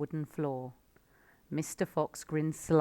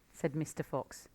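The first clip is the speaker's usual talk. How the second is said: ordinary delivery, nothing marked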